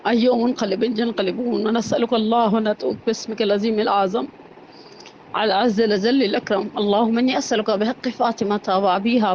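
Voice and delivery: low, steady supplication; closing prayer. Acted. Preacher leading the congregation's closing dua, hushed.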